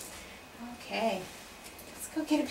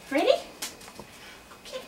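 ordinary talk

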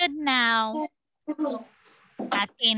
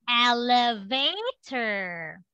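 "elevator" stretched slowly, syllable by syllable; slow model word; much slower than usual. Shown as a demonstration. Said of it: "and next".

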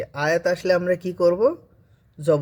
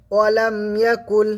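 Quran recitation; 5 semitones higher than usual. Shown as a demonstration.

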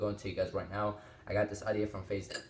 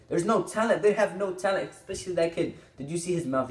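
high, louder exasperated complaint; worked-up dismissal of talentless performers; indignant rant; 8 semitones higher, 4 dB louder than usual. Real, not acted. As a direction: rant it with pitch raised. Ranting about the app's users.

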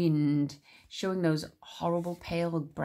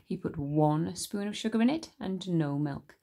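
usual speaking voice

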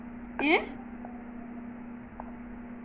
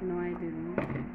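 ordinary talk

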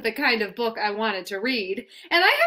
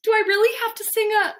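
panicked; 9 semitones higher, much faster than usual. Acted. Panicked protest before singing.

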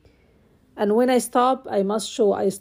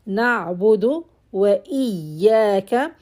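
normal talking voice

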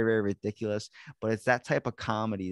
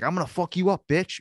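mock threat; 8 semitones higher, 5 dB louder than usual. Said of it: "He's like".

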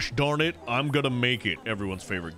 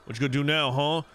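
usual speaking voice

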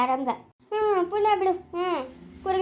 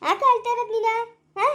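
higher, pointed repeat of the question; pressing repeat; 5 semitones higher than usual. Acted. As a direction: push the question again, higher. (ಎತ್ತರದ ಧ್ವನಿಯಲ್ಲಿ ಒತ್ತಿ ಕೇಳುವುದು)

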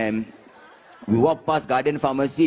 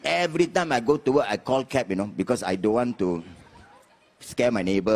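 normal talking voice

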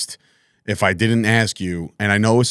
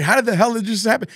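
high, quick incredulous question; hammed-up astonishment; mock bewilderment; 11 semitones higher, faster than usual. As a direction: act out baffled disbelief, pitched way up. Acting a baffled fan's reaction.

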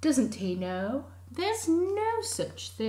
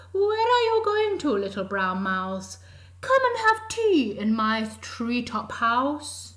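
much higher, louder owl voice; hooting owl; 5 semitones higher, 9 dB louder than usual. Acted. Owl inviting the mouse to tea, high and loud.

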